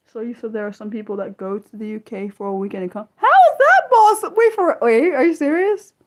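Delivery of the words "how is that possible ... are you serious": high, loud astonished squeal; can't believe it, voice jumping up; amazed disbelief. Real, not acted. Reacting to chat about a UK weekend, loud astonished disbelief.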